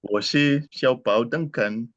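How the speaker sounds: normal talking voice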